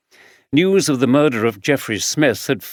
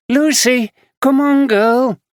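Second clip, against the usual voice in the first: high, loud call to the dog; calling back; 12 semitones higher, 5 dB louder, slower than usual. Acted. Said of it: "called back".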